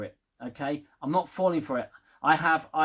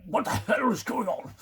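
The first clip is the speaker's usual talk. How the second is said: higher, bewildered stammer of what; confused Picard; 4 semitones higher than usual. Retold or imitated. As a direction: play him baffled, tripping over the words. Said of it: "He's like".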